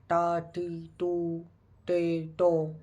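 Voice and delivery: "टा, टी, टू, टे, टो" said as crisp Japanese ta ti tu te to; teaching the Japanese T-row to viewers, syllable by syllable. Shown as a demonstration. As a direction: sound out each syllable of "टा, टी, टू, टे, टो" separately. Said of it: "ऐसे बोलते हैं Japanese में".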